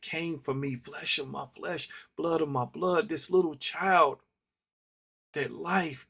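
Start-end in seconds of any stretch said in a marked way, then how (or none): none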